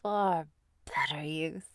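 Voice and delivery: ordinary talk, nothing marked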